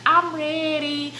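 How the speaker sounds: mock resolve. Teen self deciding she's ready, mock solemn. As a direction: declare it like a big decision. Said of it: "I was like".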